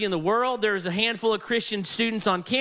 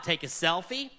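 usual speaking voice

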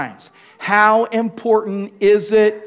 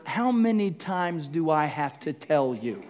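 exasperated parent; 4 semitones lower, 6 dB quieter than usual. Retold or imitated. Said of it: "said to one of your children".

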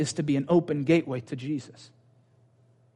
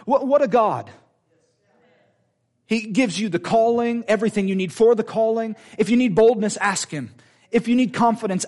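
higher, rousing pulpit voice; exalting, emphatic sermon delivery; impassioned preaching; 5 semitones higher, 3 dB louder than usual. Real, not acted. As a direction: build a fervent charge to the congregation. Preaching at full force.

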